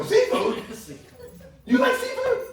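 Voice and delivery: high-pitched, incredulous delight; mock amazement. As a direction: squeak the question in hammed-up surprise. Acting out his feigned amazed reply.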